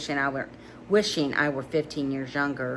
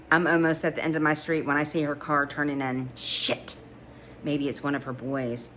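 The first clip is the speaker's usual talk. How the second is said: usual speaking voice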